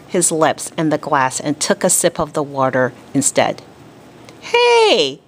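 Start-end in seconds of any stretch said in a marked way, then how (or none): 4.4-5.2 high indignant protest. A narrator's reading. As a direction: object like a wronged boy. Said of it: "Peter protested".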